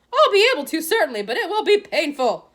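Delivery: high, loud, no-nonsense nurse; brisk matron. A narrator's reading. Matron answering Harry, loud and brisk. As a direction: reassure but warn, briskly.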